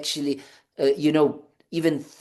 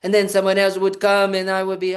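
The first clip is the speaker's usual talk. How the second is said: usual speaking voice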